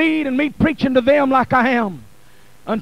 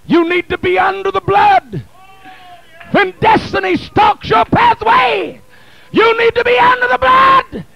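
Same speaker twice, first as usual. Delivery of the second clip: building from steady to a loud, higher cry on blood; preaching at full force; 5 semitones higher, 5 dB louder than usual. Acted. Preacher urging the congregation, rising to full-force preaching. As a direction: whip the church up, climbing each line.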